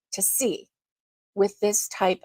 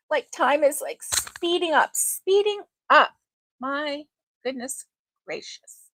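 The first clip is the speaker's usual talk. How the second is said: surprised; 5 semitones higher than usual. Real, not acted. Amazed August is nearly over.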